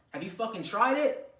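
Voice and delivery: usual speaking voice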